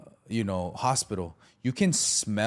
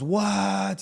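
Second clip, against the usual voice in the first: a sudden high, loud yelp of outrage; a man demanding why he was told he's wrong, shouting in disbelief; outraged what; 10 semitones higher, 4 dB louder than usual. Retold or imitated. A man just released from jail, told he's wrong, shouting in disbelief. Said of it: "He starts blowing up".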